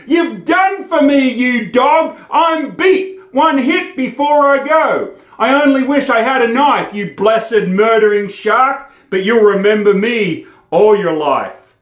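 high, loud, hammed-up fury of a dying man; desperate defiant shout. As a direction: roar it out as if with your last breath. Bushman cries out, throat cut.

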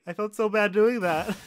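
ordinary talk

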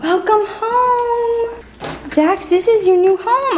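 high, slow cooing welcome; talking to a puppy. Real, not acted. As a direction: draw it out sweetly for the puppy. Welcoming puppy in baby talk.

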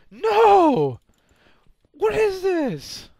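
loud high shriek; real startled screaming; panicked scream. Real, not acted. Another ambush, screaming.

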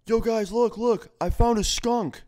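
put-on high, loud, excited drunk partygoer; excited drunk guy. Drunk guest showing friends his skunk through glass, excited mock yell. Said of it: "Mister Dumbass Drunk Guy".

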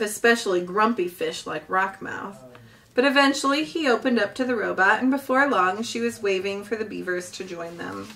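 ordinary delivery, nothing marked

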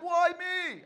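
loud high wail; despairing cry. Retold or imitated. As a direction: cry out to the heavens. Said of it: "I was like".

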